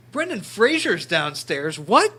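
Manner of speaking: slow then loud high "What?"; retold disbelief. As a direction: act the stunned fan again, slow then shooting up. Retold shock at the door.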